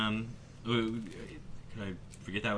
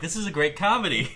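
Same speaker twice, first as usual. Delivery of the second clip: higher and louder, laughing through it; mock proud announcement; 8 semitones higher, 6 dB louder than usual. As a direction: proclaim it boldly while cracking up. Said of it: "they came out and they were like".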